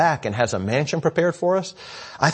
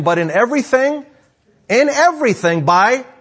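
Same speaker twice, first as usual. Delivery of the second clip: high, loud, driving sermon voice; raised pulpit delivery; full-force preaching; 9 semitones higher, 5 dB louder than usual. Real, not acted.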